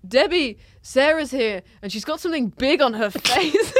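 high, loud announcing call; shouting across the room. Retold or imitated. Friend shouting news across the room, loud and high. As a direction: holler it to someone across a bar. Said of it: "he shouts across the room".

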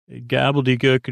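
usual speaking voice

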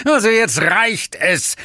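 slow, booming demon lord voice; the lord of hell losing patience; thundering Asmodes. Acted. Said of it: "die donnernde Stimme des Höllenherrschers Asmodes". (donnernde Teufelsstimme)

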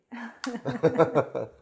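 low laugh. Real, not acted. Host laughing about coffee.

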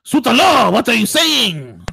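loud, high, outraged Frenchman; hammed-up French indignation; mock French accent. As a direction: exclaim like a cartoon Frenchman. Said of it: "The French guy would".

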